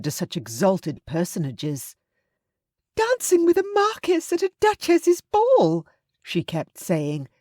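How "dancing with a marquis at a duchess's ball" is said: very high-pitched, thrilled mother's squeal; incredulous excitement. A narrator's reading. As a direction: gush in disbelief at a dazzling honour. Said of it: "as though she could not quite believe it".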